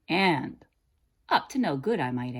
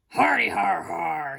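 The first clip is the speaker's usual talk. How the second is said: laughed wildly; 5 dB louder than usual. A narrator's reading. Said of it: "he laughed wildly".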